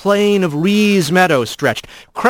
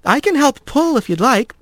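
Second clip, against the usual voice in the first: worried; 9 semitones higher than usual. Acted. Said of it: "worry in her voice".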